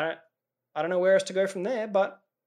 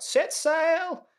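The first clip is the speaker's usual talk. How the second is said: put-on sudden high-pitched cry of an old guy; mock yell; 13 semitones higher, 4 dB louder than usual. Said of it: "he just randomly yells out".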